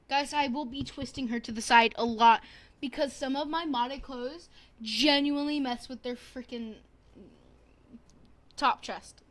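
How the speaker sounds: loud, emphatic explanation. Real, not acted. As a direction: push it out loudly to viewers.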